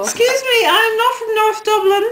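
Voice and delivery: high-pitched playful outrage; mock indignation. Denying being a North Dubliner to Titch, mock indignant. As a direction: protest high, as if deeply insulted.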